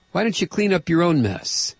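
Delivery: ordinary talk, nothing marked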